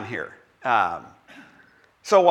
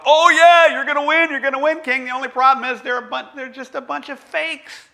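loud, high mock cheering; fawning yes-men; 10 semitones higher, 8 dB louder than usual. Fake prophets egging on the king, mock cheer. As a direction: gush like eager flatterers. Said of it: "all the other guys are going".

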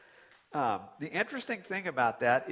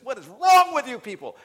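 much louder, high exasperated cry; a loud, exasperated rebuke of the grumbling fans; exasperated shout; 9 semitones higher, 13 dB louder than usual. Real, not acted. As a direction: throw up your hands at them. Exasperated outburst.

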